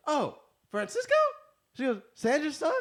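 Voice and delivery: high, loud, sudden friendly recognition; a mom's voice lighting up with delight; surprised delighted mom. Retold or imitated. Mom on the phone, recognizing a friend's son, surprised delight.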